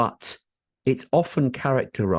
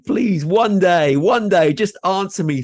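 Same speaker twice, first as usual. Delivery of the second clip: playful pleading; 8 semitones higher, 5 dB louder than usual. Real, not acted. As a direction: beg your co-host in mock exasperation. Host begs co-host for answer.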